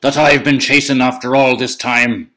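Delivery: booming giant. Acted. Giant boasting in story.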